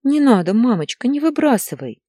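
put-on high child's plea; tearful begging. A narrator's reading. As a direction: beg like a boy choking on tears. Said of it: "захлёбываясь слезами и соплями". (детская мольба сквозь слёзы)

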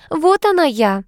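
high, coy little announcement; coquettish. A narrator's reading. Niece showing off to the suitor, coquettish. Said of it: "кокетливо произнесла девица-красавица".

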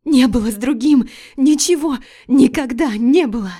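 wife pleading with husband; desperate shout. Acted. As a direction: scream the denial. Wife screaming denial.

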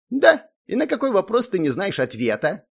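high, teasing gnome voice; smirking gnome. A narrator's reading. Gnome teasing the hero about his questions, smirking. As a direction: tease them with a high mock question. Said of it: "усмехнувшись".

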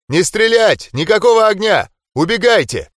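high, loud frantic yelling. Acted. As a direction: yell and wave them off. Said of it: "начал орать и махать руками".

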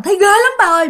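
high scared plea to dad; frightened child. Retold or imitated. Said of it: "sợ quá mà các bạn".

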